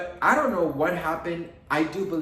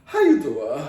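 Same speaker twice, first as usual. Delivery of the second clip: Wendy Williams catchphrase; 2 semitones higher, 7 dB louder than usual. Acted. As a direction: toss it out as a loud catchphrase. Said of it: "or should I say".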